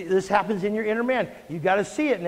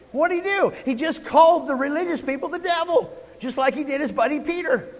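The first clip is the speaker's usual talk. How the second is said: high, excited disbelief; incredulous exclaim; 6 semitones higher than usual. Real, not acted. Preacher amazed at his congregation, high excited exclaim.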